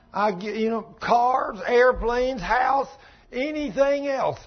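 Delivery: rising, fervent pulpit climax; preaching at full force. Acted. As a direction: preach it out with all you've got.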